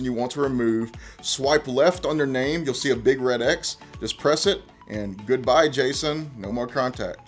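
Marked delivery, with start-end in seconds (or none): none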